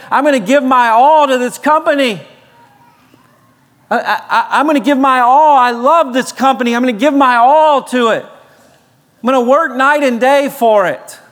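mock zealous vow to give it all; eager pledge. Imitating devoted worker.

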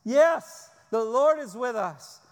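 a sudden loud yes of joy; mock jubilant cry. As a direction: cheer like a relieved crowd. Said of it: "the people are like".

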